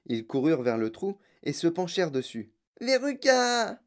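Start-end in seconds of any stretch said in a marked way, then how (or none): 2.8-3.8 high drawn-out call; calling cry. A narrator's reading. Madame Salt calls her daughter down the hole, calling cry. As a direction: call down a hole for a lost child. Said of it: "cria madame Salt".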